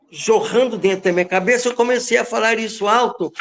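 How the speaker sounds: ordinary talk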